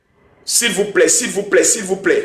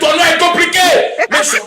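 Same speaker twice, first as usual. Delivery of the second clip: high-pitched protest; exasperated exclaiming; 8 semitones higher than usual. Real, not acted. Host stuck on a caller's name, exasperated exclaiming. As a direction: throw it out high, as if giving up on the name.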